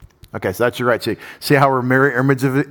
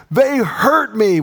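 whiny wronged person; 9 semitones higher, 3 dB louder, slower than usual. Retold or imitated. Voicing a wronged person's complaint.

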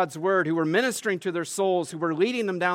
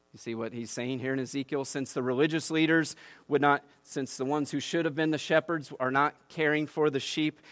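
ordinary talk

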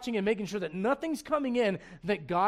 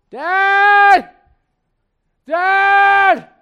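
put-on much higher, very loud child's call across the house; kid calling Dad; 11 semitones higher, 20 dB louder, much slower than usual. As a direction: yell for Dad from the other side of the house. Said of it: "the pitch that goes up and down".

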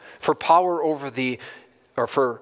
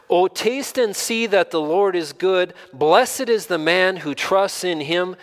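raised, loud exclamation, slowly drawn out; emphatic; 5 semitones higher, 4 dB louder, slower than usual. Acted. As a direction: proclaim it slowly with strong emphasis. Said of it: "notice the emphatic nature of this".

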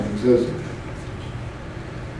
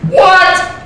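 mock disbelief; 13 semitones higher than usual. Reacting to the question.

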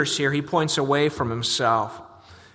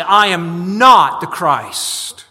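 slow, louder, with the weight on "not" in "I am not the Christ"; emphatic denial; 6 dB louder, much slower than usual. Acted. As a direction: lean hard on "not", drawing each word out. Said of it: "John categorically denies".

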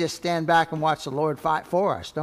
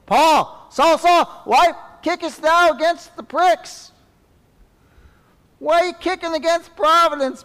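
much higher, loud calling out; voice of the Lord; 13 semitones higher, 8 dB louder than usual. Acted. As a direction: call down to Saul from heaven. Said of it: "And he said".

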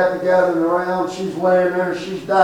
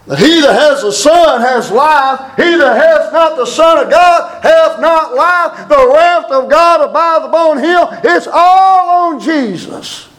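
high and building to a slow shout; preaching at full force; 9 semitones higher, 3 dB louder, slower than usual. Acted. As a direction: thunder the climax at the congregation. Preaching climax.

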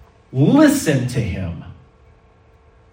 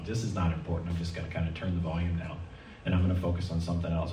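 low, soft inner thought; tuning out; 6 semitones lower, 12 dB quieter, faster than usual. Acted. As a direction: murmur it quickly to yourself, barely engaged. Voices someone tuning out a talker.

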